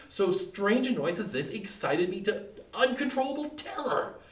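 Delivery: ordinary delivery, nothing marked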